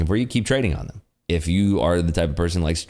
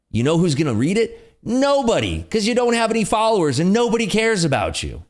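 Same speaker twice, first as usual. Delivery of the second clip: exasperated rant; 11 semitones higher than usual. Real, not acted. Mocking ranting retweeters.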